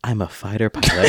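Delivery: low, sultry and a bit giggly; sexy voice. Pretend. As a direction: purr it out like a seducer. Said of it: "talk in a sexy voice".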